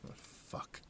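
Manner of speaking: breathy whispered curse; barely voiced, under the breath. A narrator's reading. Narrator whispering to himself in shock. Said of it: "I whispered".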